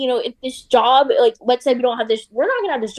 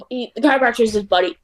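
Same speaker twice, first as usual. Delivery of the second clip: usual speaking voice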